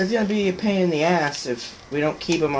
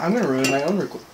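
ordinary delivery

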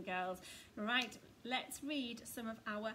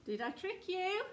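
teasing question; 7 semitones higher, 5 dB louder than usual. Pretend. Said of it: "let's see if I can trick you".

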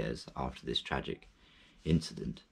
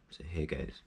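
whisper; 8 dB quieter than usual. Real, not acted. About to start reciting.

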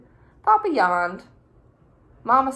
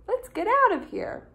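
high character voice; 12 semitones higher than usual. Acted. Character line voiced in story.

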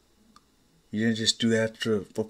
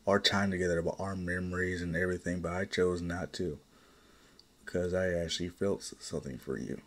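softer, lower, heartfelt admission; tender confession; 4 semitones lower, 5 dB quieter, faster than usual. Acted. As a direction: let the feeling come out quietly. Husband confesses his love to family.

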